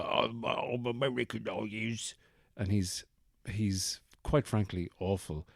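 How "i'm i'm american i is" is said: mock Londoner's botched American accent, soft; bad American accent. Host mocks London actor playing American, bad American accent. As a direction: badly fake an American accent, quieter. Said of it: "an American with a really bad accent".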